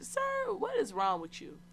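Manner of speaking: high-pitched disbelief on "Sir"; mock-outraged telling-off; acted indignation. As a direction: play up being appalled, voice jumping high. Retold indignant reply to rude man.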